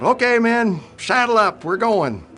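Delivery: high, loud call to mount up; officer shouting to his men; commander's yell. Retold or imitated. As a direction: bark it across the airfield. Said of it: "the C O yelled".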